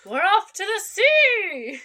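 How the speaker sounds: high, loud, drawn-out mock rallying cry; mock adventurous cry. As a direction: call it out like a jolly explorer. Joking cry after the misread.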